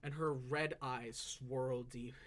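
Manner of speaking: ordinary delivery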